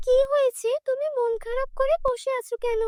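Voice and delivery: lightly voiced caring fairy; kind fairy. A narrator's reading. Fairy asks.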